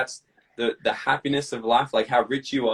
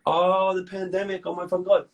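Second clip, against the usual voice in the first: hamming up the self-pity; mock whiny victim; 7 semitones higher than usual. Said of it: "this is a great reason".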